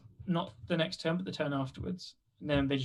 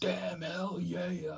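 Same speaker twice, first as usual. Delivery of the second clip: American impression; 3 semitones higher, 6 dB quieter, much slower than usual. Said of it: "your best American impersonation".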